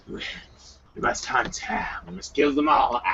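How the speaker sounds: rough gravelly growl. Acted. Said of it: "It's hard doing the gravelly voice".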